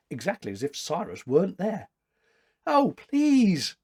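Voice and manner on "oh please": pleading cry. A narrator's reading. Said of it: "cried the girl".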